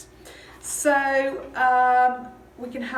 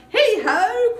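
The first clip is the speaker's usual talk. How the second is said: high, ringing halloo; loud call swooping upward; Fezziwig's cry; 14 semitones higher, 11 dB louder, slower than usual. Acted. Old boss hailing his apprentices, exuberant cry. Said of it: "cried old Fezziwig".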